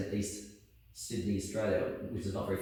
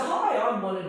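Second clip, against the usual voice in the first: own younger self; 10 semitones higher, 4 dB louder than usual. Retold or imitated. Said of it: "I said".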